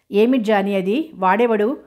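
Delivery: ordinary delivery, nothing marked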